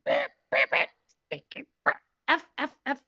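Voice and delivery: soft breathy beeping gibberish; mock alien speak. Showing the listeners how the alien's language sounded to her, soft breathy beeps. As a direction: fake an alien tongue in quick little beeps. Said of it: "of course, I'm speaking French".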